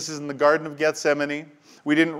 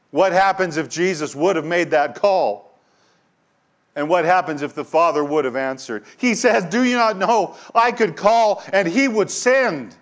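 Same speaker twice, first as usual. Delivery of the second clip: much higher, louder awestruck preaching; peak preaching fervor; 7 semitones higher, 5 dB louder than usual. Acted. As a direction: let the wonder break out at full force. Preaching climax, awe.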